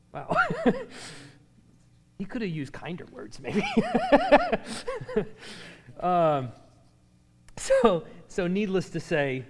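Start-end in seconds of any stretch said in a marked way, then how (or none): 3.7-5.5 real, high-pitched laughter; amused laugh. Real, not acted. Preacher reacting to harsh scripture, amused laughter. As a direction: laugh at your own understatement.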